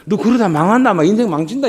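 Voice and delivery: worried scolding. Retold or imitated. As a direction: scold out of worry, not hate. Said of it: "이 말입니다".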